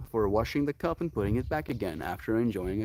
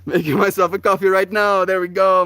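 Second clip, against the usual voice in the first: loud, higher-pitched burst of gusto; loud announcement; 8 semitones higher, 10 dB louder than usual. Real, not acted. Announcing a coffee to oneself, loud and high. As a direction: belt it out with sudden energy.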